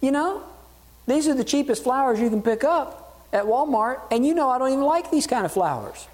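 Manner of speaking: high, scornful wife voice; complaining wife. Retold or imitated. Wife scorning her husband's cheap Walmart flowers, high scornful voice. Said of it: "she goes".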